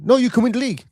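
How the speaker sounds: hammed-up pundit telling fans they'll win; mocking pundit. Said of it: "And then they were going".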